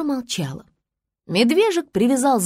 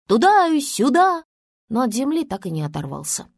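plain reading voice

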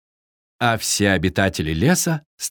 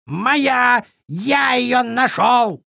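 high, stretched raven cry; insistent raven; 12 semitones higher, much slower than usual. A narrator's reading. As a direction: claim it in a high squawk. Raven insists the find is his.

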